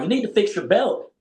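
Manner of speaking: put-on country accent. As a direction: imitate a sergeant ordering in a country accent. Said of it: "he had a country accent".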